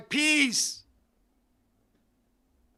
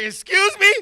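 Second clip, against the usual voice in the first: higher and louder, incredulous; mock baffled; 9 semitones higher, 7 dB louder than usual. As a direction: pop up in disbelief. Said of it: "I was like".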